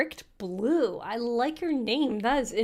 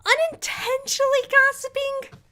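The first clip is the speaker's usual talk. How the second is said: mock disbelief; 6 dB louder than usual. Mock disbelief at chat's claim.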